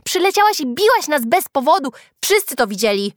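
loud, high accusing voice; heated accusation. A narrator's reading. Girl accuses Julka of hitting them, loud accusation. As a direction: accuse her loudly.

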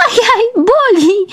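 very high, drawn-out yelp; shrieking. A narrator's reading. Tickled girl crying out in pain, high shriek. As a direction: squeal in mock agony. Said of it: "wrzasnęła Tatiana".

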